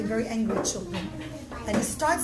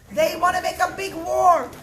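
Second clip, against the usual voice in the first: alarmed warning; 6 semitones higher, 8 dB louder than usual. Acted. Warning the emperor.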